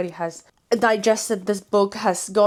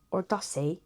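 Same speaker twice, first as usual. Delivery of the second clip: put-on British accent; 8 dB quieter than usual. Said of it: "had to go British there".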